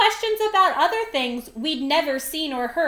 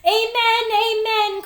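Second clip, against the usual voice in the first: croaked; 7 semitones higher, 3 dB louder than usual. A narrator's reading. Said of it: "croaked Mister Lowe".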